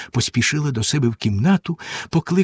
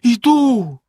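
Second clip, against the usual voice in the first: high, louder despairing cry; 10 semitones higher, 5 dB louder than usual. A narrator's reading. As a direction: answer in despair. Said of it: "розпачливо сказала дружина". (розпачливо)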